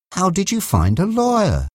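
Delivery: high-pitched, astonished question; taken aback, pitched well up; shocked. A narrator's reading. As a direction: ask it in mild shock, voice lifted. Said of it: "looking shocked".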